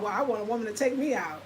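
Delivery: usual speaking voice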